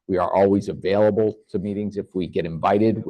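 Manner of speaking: ordinary talk, nothing marked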